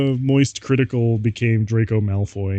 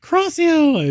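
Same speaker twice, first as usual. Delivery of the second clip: much higher, louder spell yell; shrieked spell; 5 dB louder than usual. Acted. Narrator shrieking the curse at the vampire, far higher. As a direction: scream the curse at full pitch. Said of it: "I shouted".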